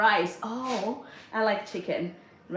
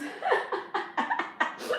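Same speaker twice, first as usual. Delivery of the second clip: high, airy laugh; amused laugh; 4 dB quieter than usual. Real, not acted. Host amused by her own dinner-table rule, high laugh. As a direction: let out a high burst of laughter.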